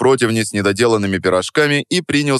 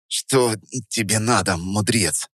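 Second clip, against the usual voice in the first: quiet, irritated grunt; understated gruff brush-off; grumbled; 5 dB quieter, slower than usual. A narrator's reading. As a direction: mutter it without interest, mildly annoyed. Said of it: "буркнул я".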